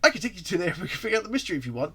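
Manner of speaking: Tails. Acted. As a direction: pitch it up as the eager young sidekick. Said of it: "No, wait, Tails says that".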